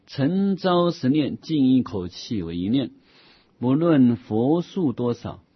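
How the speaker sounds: slow, measured reading of scripture; measured recitation of an old Buddhist text; formal text recitation. Acted. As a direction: read the classical passage slowly and deliberately. Reads a classical Buddhist text aloud.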